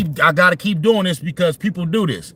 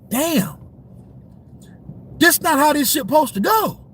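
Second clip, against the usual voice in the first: imitated inner outburst; 7 semitones higher than usual. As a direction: blurt it out like a player watching his plan fall apart. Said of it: "he was thinking in his head like".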